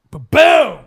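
loud ba-boom sound effect; loud mock boom; 1 mock explosion sound. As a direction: punch it out like a blast.

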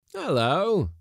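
high, loud greeting hail; calling back. Acted. Skipper greeting the lady on the jetty, calling out. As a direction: holler a hello across.

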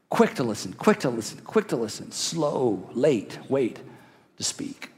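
low, soft, measured counsel; hushed caution. Shown as a demonstration. Preacher urging listeners to wait to speak, hushed caution. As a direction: drop low and fade away on the last words.

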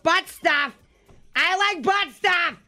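goofy high-pitched voice; squeaky cartoon voice; silly high voice. Silly joke voice.

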